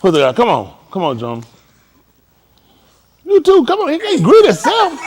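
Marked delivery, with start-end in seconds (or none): 3.2-5.0 laughing tease. Real, not acted. Coach teasing student.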